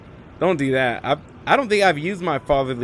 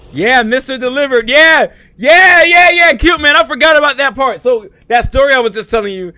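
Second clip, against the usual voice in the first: loud, high hype shout; real hype at full volume; excited yelling; 14 semitones higher, 14 dB louder than usual. Real, not acted. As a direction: yell it out, pumped up after the play. Hyped yelling during a game.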